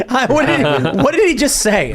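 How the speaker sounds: high-pitched, incredulous laugh through the words; laughing in disbelief; baffled laughter. Real, not acted. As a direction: ask it helplessly, cracking up, pitch way up. Baffled laughing at the played clip.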